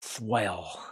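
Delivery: low, soft word pushed through gritted teeth; gritted-teeth Clint. Retold or imitated. Seller imitates Eastwood for viewers, low and soft through teeth. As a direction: grind it out like a tough cop. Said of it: "he's always gritting his teeth".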